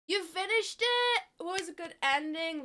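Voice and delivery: surprised and thrilled; excited. Real, not acted. Excited reaction to chat.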